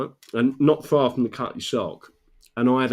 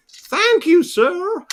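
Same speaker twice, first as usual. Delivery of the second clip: loud, high American tourist thanks; exaggerated American accent, pitched way up; mock American accent; 5 dB louder, much slower than usual. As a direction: chirp it in put-on American. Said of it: "That's my accent at the moment for the Americans".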